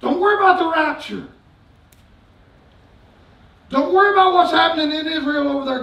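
shouting sermon, high and loud; fiery preaching. Acted. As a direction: preach it at full force to the congregation. Preaching at full force.

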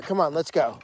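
normal talking voice

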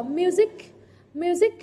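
"music" stressed on MU with a rising end; stress before -ic. Shown as a demonstration. Teacher modelling the -ic rule, "music" stressed on MU. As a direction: lean on the first syllable of "music". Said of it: "Trọng âm rơi vào âm tiết trước nó".

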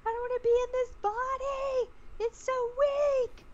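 high, loud panicked scream; retold panicked screaming. Under hypnosis, screaming to the hypnotist in panic. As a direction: scream it in panic, trapped and desperate. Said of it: "I started screaming".

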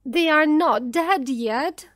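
high, loud, frantic protest; screamed denial. A narrator's reading. Mother screaming that her children live, frantic. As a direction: cry out in refusal. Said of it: "screamed Missus Dawson".

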